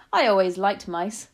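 loud, chatty cabbie; old taxi driver. A narrator's reading. Taxi driver tells passenger his fondness, loud and chatty. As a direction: say it heartily as a cabbie.

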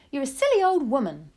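high, loud, cross wife; indignant insult. A narrator's reading. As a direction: scold her loudly.

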